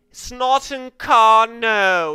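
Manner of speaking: hammed-up pedant stretching out each syllable; mock correction. Gamer recalling classic tournament moments, mock drawn-out correction.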